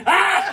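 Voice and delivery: high shrieking ah, laughing back; mock hysterical laugh-shout. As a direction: blast a high hysterical ah at him. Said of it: "and I go".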